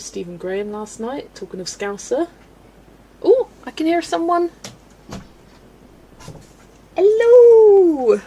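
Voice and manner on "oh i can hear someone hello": loud, very high pet greeting; excited high-pitched hello. Real, not acted. Calling to a pet.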